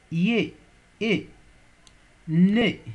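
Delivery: "yé" and "nez" with a closed é, like pied; closed E sounds. Shown as a demonstration. Teacher modelling the closed é for students, clear and careful. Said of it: "on le prononce comme dans les mots".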